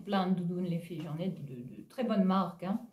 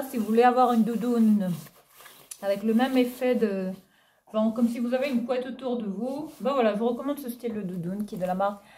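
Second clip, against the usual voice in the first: normal talking voice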